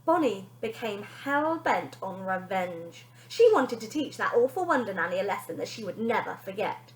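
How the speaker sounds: ordinary delivery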